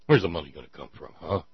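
sarcastic mock of the one-percent question; mocking sneer. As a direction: throw the question back with contempt. Host mocks the one-percent question.

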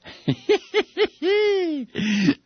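long, high-pitched cackle; high giggling cackle. Real, not acted. Host tickled by Clark getting fired up, cackling. Laughs at nuking remark.

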